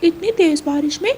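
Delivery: high, quickened startled greeting; surprised parrot. Acted. Parrot opens the door to Ranu in the rain, surprised. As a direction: ask in surprise, pitched well up.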